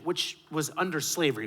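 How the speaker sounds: normal talking voice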